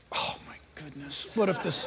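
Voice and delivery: 气声低语，像小鸟在焦虑; 轻声担忧地低语; worried whisper. Pretend.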